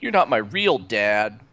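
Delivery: loud, drawn-out mock teenage protest; hammed-up kid with an attitude; bratty kid attitude. Podcaster spoofing Finn as a kid with attitude, mock bratty. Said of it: "brought kids' attitudes up".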